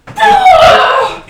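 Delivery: very high, loud screaming laugh; shrieking laugh. Real, not acted. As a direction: howl with laughter. Huge laugh at punching windows.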